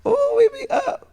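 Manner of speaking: high giddy laugh; excited squeal. Pretend. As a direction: squeal with delight. Imagining winning fifty grand.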